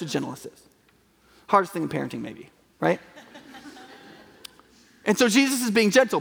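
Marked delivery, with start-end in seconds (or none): none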